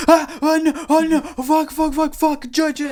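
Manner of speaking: high, loud panicked yelling for help; screaming in a panic for his brother. Retold or imitated. Panicking teen yelling for his brother, high and loud. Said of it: "I start going".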